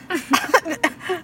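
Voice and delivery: helpless high wheezing laugh; laughing too hard to speak; hysterical laugh. Real, not acted. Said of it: "Why are you laughing so hard?".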